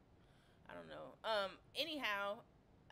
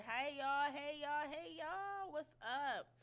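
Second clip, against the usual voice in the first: louder, higher hey to the chat; excited greeting; 6 semitones higher, 4 dB louder, slower than usual. Real, not acted. Streamer greets chat after fixing volume, louder and brighter. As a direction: greet the viewers with a lift.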